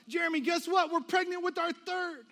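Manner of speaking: very high, loud rush of news; excited blurting. Retold or imitated. Friend phoning with pregnancy news, giddy high-pitched blurt. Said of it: "Shane blurts out".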